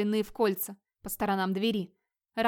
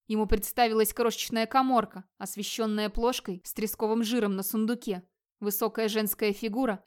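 plain reading voice

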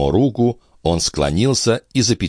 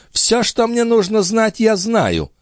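high feminine declaration; declaring countess; slower than usual. A narrator's reading. Countess dismissing the police chief's warning, high declaration. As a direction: cut off argument in a lady's high voice. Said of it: "объявила она".

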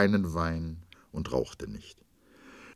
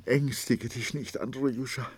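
trembling; 7 semitones higher than usual. A narrator's reading. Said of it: "die wie im Fieber bebte".

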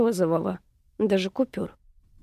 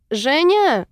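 raised, higher call from above; 6 semitones higher, 4 dB louder than usual. A narrator's reading. Friend calls down to Zhenya from a window above, calling out. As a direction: call her name out from upstairs. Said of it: "услышала она откуда-то сверху".

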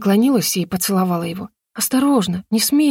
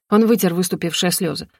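ordinary delivery, nothing marked

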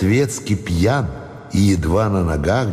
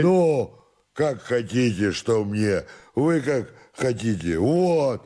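soft, higher tipsy grumble; sullen drunken servant; 4 semitones higher, 4 dB quieter, slower than usual. Acted. Drunken servant shrugs off the master, soft sullen grumble. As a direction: brush it off quietly, tipsy and stubborn.

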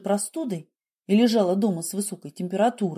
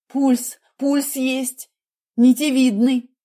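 high, excited report; urgent relief; 5 semitones higher, 5 dB louder, much slower than usual. A narrator's reading. Nurse tells surgeon the pulse is back, excitedly. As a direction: call it out high as hope returns.

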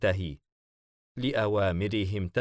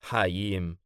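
ordinary delivery, nothing marked

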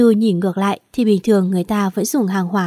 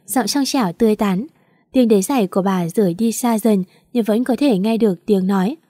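plain reading voice

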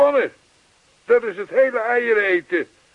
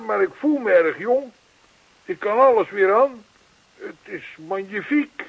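ordinary delivery

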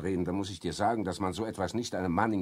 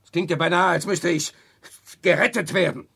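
higher and louder, offended; indignant outburst; 7 semitones higher, 8 dB louder than usual. Acted. Victor rejecting Walter's job offer, indignant and loud. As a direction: throw the offer back at him.

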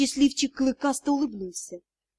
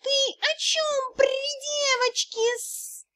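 high, slow hissing kitten voice; 13 semitones higher, slower than usual. A narrator's reading. Kitten rebukes the dragon before a girl, high hiss. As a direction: scold in a squeaky, drawn-out cat hiss. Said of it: "прошипела царапка и добавила".

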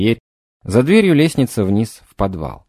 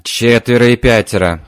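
ordinary delivery, nothing marked

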